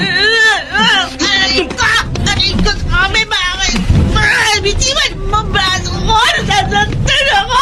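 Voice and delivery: wife's arm gripped, crying out in pain. Acted. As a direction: wince and protest, he's hurting you. Husband twists wife's arm.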